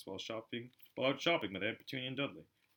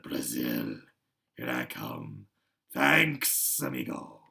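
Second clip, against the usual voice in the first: much lower, slow hissing snake voice; 11 semitones lower, 6 dB louder, much slower than usual. A narrator's reading. The escaping snake thanks Harry in passing. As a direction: hiss it like a departing serpent. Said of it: "a slow hissing voice said".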